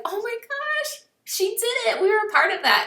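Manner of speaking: high, thrilled squeal of joy; proud coach cheering a teammate's success, squealing high with joy; excited cheer. Pretend. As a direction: cheer her on from the sidelines, pitch way up. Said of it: "sit back on the sidelines and be like".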